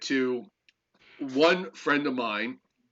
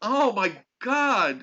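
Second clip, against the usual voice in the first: exasperated; 7 semitones higher, 4 dB louder, much slower than usual. Real, not acted. Reaction to Lee and Perrins.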